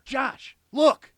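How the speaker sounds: high, loud call across the graves; urgent call. A narrator's reading. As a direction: call him over in alarm. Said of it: "I called".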